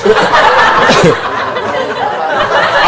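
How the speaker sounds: long, wheezy laughing fit; real laughter. Real, not acted. Comic after the round of applause joke, wheezing with laughter. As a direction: dissolve into helpless laughter.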